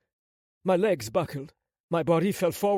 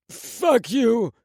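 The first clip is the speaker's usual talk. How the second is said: loud, raised, forceful shout; defiant curse with all strength; 7 semitones higher, 7 dB louder than usual. A narrator's reading. Wounded prince to his attacker, defiant shout. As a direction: spit it out with your last strength. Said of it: "With all the strength I could muster".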